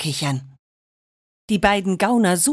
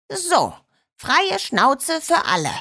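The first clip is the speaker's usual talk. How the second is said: higher, grinning and pleased; 6 semitones higher than usual. Acted. Schorsch frees the puppies' muzzles, grinning and higher. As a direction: say it through a broad grin. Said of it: "grinste er".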